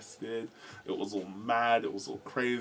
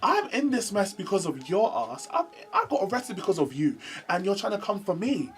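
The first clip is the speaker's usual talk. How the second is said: higher-pitched retold indignation; indignant comeback; 9 semitones higher than usual. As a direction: act out an affronted confrontation. Retold quarrel with accusing schoolmate.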